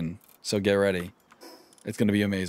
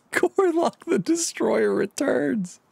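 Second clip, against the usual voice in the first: dramatic high announcer voice; 14 semitones higher than usual. Acted.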